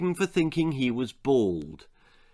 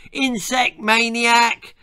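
high, loud, drawn-out yell; taunting shout; 10 semitones higher, 5 dB louder, slower than usual. Acted. Ginger shouting at the old boy's back, taunting. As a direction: holler it over a fence. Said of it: "and shouted".

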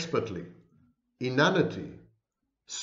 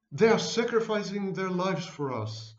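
higher mock gushing fan voice; adoring fan; 6 semitones higher than usual. Fan praising gurus' sacrifice, mock gushing higher voice. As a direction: parody a devoted follower's praise. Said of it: "I heard someone writing".

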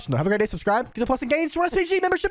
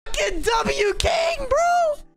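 stretched-out victory shout; hyped shout; 11 semitones higher, much slower than usual. Real, not acted. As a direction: cheer the king like a fan. Hyped reaction to the king.

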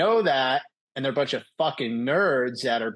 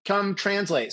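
higher-pitched, lightly voiced attending; calling student over; 8 semitones higher than usual. Attending calls the student in to translate, lightly voiced summons.

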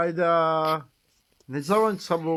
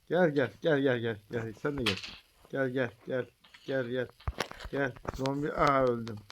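sleepy quiet calling; drowsy mumbled calling; nodding off; 4 semitones lower, 7 dB quieter than usual. Real, not acted. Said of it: "Benim iyice uykum geldi".

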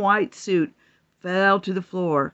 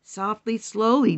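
usual speaking voice

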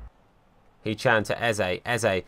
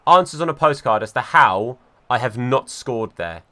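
ordinary talk

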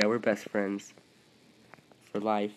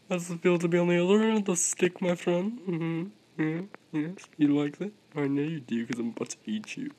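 higher, fast, smug teasing; gloating taunt; 5 semitones higher than usual. Pretend. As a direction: rub it in to the shrunken rival. Taunts smaller rival in game.

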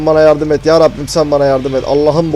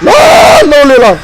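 high, loud, drawn-out shriek; startled scream; 9 dB louder, slower than usual. Real, not acted. Gamer caught by a sudden scare, startled scream. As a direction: yell in sudden fright.

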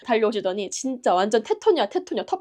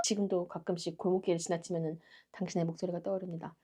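soft, lower, wistful recollection; quiet mock-solemn reminiscence; sentimental tribute; 4 semitones lower, 13 dB quieter than usual. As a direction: drop to a hushed, heartfelt narration. Mock-sentimental closing tribute to woman.

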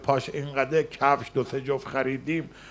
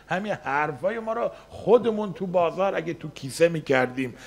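ordinary delivery

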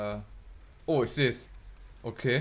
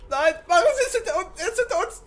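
being chased; 10 dB louder than usual. Real, not acted. Being chased, yelling warning.

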